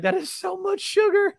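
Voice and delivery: very high-pitched mock disbelief; losing composure. Podcaster retelling a host's shock at Kool-Aid, losing composure. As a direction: squeal it as if cracking up. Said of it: "absolutely loses his composure on the set".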